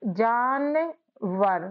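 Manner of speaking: जानवर stretched very slowly, each syllable held; drawn-out word. Shown as a demonstration. Teacher stretching जानवर so students hear both halves. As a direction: draw out जानवर slowly for the class. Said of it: "कैसे".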